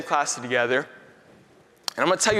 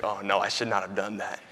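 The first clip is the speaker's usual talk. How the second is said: quiet inner realization; 6 semitones lower, 6 dB quieter than usual. Retold or imitated.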